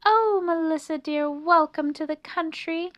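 high, loud delighted welcome; gushing hostess mouse. A narrator's reading. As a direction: greet a dear friend with delight. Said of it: "Annie was delighted".

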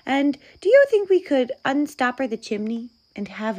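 high, eager little voice; chattering high-voiced mouse request; hopeful mouse. A narrator's reading. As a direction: ask politely as a tiny mouse, speeding up. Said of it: "said the mouse".

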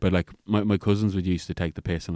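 ordinary talk, nothing marked

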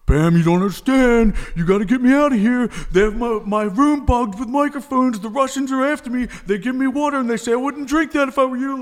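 high, frantic voice of a panicked friend; paranoid friend. Retold or imitated. Friend on the phone from the institution, high and frantic. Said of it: "He's like".

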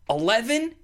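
usual speaking voice

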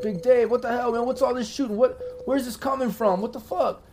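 put-on high, slurring drunk yell from down the hall. Drunk neighbor shouting about the gunfire, high drunken yell. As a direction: holler like a confused drunk. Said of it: "that drunk dude yelling".

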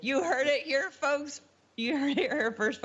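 high playful announcer voice; mock announcer. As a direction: sell it like a newscaster. Mock news announcer sign-off.

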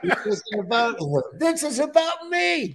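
high, laughing mock vanity; mock diva. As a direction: whine it like a diva, joking. Joking self-centred outburst.

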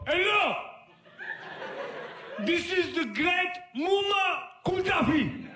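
put-on high, booming accented shout; Gaddafi prank call. Prank calling the new hardware guy as Gaddafi, booming accented shout. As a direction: announce yourself like a grand dictator. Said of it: "I go".

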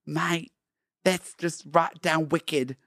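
put-on amateur Australian tourist accent. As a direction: put on a loose Aussie accent. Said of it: "do an Australian accent".

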